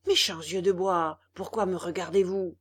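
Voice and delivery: loud, irritated scolding. A narrator's reading. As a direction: scold the wooden eyes, annoyed. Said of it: "dit d'un ton irrité".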